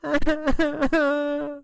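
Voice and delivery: high, drawn-out acted sobs; hammed-up high wailing; mock crying. A narrator's reading. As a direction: cry like the boy who got hit. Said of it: "The boy cries".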